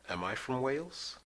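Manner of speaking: ordinary talk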